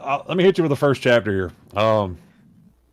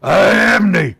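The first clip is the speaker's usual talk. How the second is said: high, loud orc call; shouted orc voice; 9 semitones higher, 8 dB louder than usual. Acted. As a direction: holler it out as an orc. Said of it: "shouted a voice".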